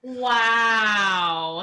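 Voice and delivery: loud, long drawn-out wow; stunned wow. Real, not acted. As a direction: let out a big, belted reaction of shock. Shocked reaction to cabbie story.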